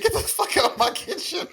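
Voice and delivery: high, laughing mock order. Son retelling mom kicking him out of the kitchen, laughing. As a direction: squeeze the retold line through laughter. Retold mom's line, cracking up.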